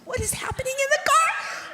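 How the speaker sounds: high, loud mock-panicked squeal; frantic worry. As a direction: shriek it like a mom spying helplessly. Anxious mother watching from kitchen window.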